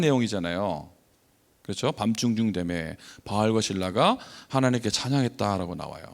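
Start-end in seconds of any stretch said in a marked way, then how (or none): none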